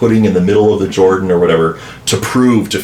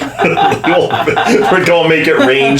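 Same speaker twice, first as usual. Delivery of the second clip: laughing; 4 semitones higher, slower than usual. Pretend. Joking money-rain boast.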